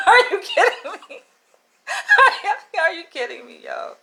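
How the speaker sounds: laughing, high, are you kidding me; high laughing disbelief. Real, not acted. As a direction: crack up in disbelief.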